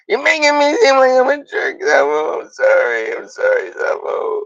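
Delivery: hammed-up cry-shout of sorry; mock crying. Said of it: "enough of crying".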